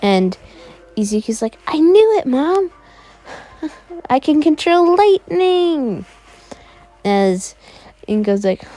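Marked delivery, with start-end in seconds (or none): 1.7-6.1 put-on thrilled kid. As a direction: burst out with giddy triumph. Kid thrilled by his power.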